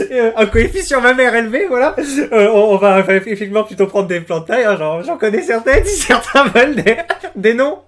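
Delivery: parler en riant, aigu et fort. Real, not acted. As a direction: vrai fou rire qui déborde sur les mots. Rit de sa propre allusion grivoise. (hilare, voix haut perchée)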